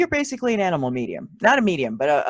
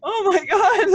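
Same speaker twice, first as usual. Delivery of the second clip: much higher, crying with laughter; laughing to tears; 13 semitones higher, 5 dB louder than usual. Real, not acted. Host overcome laughing at the guest. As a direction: gasp it out through tears.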